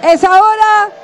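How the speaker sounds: rousing rally call; booming balcony speech. Real, not acted. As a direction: call it out to the crowd. Rally chant to the crowd.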